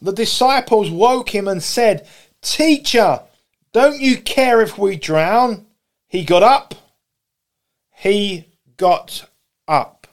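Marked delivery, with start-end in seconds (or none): 2.4-5.7 high, urgent call; acted distress, pitched up; alarmed plea. Disciples waking Jesus in alarm. 6.1-6.7 louder, lifted on UP; brisk, forceful statement; emphatic reading. Acted. Reads verse, then repeats for weight. 7.9-10.1 each word set apart by pauses; very slow, deliberate; slow, weighty repeat. Acted. Repeats verse slowly for weight.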